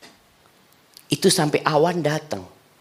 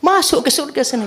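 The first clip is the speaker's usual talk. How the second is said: higher voice; mock divine voice; 13 semitones higher than usual. Preacher voicing God telling the man to enter. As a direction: lift the voice for God.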